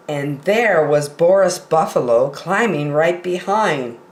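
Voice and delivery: ordinary delivery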